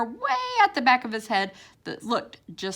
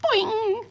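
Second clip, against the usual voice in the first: springy boing; 14 semitones higher, 3 dB louder than usual. A narrator's reading. Hair springs back.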